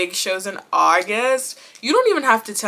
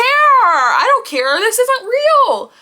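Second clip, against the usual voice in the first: slow, loud, stretched-out insistence; drawn-out defiance; 13 semitones higher, 7 dB louder, slower than usual. Real, not acted. Still defying the fans, she stretches the words out slow and loud. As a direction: lean on every word and make them wait.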